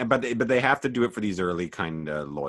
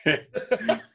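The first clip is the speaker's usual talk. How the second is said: laughing dad mockery; 7 semitones higher, 5 dB louder than usual.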